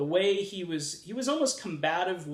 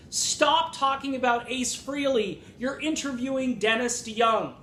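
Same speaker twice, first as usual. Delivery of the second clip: louder and higher, fed-up scolding; exasperated rant at the interviewer; exasperated outburst; 7 semitones higher, 4 dB louder than usual. Real, not acted. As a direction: tell the absent interviewer off, fed up. Vlogger scolds absent interviewer.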